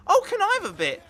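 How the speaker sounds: high, loud mock girlish request; pleading girl. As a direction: imitate her cheekily begging a bite. Imitating girl asking for his food.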